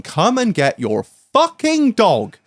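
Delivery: high, drawn-out mock yell; retold shout. Wife calling out the dog's owner, retold high shout. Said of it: "my wife is shouting".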